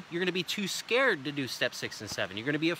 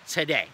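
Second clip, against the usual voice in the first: today said firm and a bit louder; stress on today; 2 semitones lower, 4 dB louder than usual. Shown as a demonstration. Salesman stressing "today" to close the deal. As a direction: land hard on "today" at the end. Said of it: "Make sure you say the word today".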